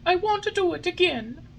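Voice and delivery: put-on high tearful puppet boy; pleading through tears; tearful Pinocchio. A narrator's reading. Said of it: "cried Pinocchio in tears".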